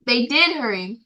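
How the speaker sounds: usual speaking voice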